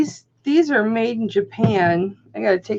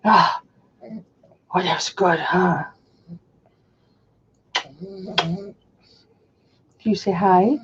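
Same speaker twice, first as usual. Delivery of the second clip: talking to a dog. Real, not acted.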